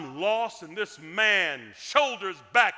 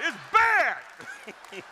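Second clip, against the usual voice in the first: laughing; 9 semitones higher, 5 dB louder than usual. Retold or imitated. Repeated with laughter, drawn out.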